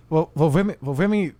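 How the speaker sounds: ordinary talk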